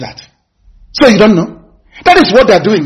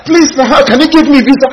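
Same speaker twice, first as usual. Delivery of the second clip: high pleading mock beggar; hammed-up high beggar plea; mock begging; 6 semitones higher than usual. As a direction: whine it like a visa beggar. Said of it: "When you're begging".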